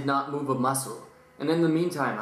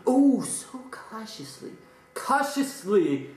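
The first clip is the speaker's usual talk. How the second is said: slow dramatic emphasis; 6 semitones higher, slower than usual. Acted.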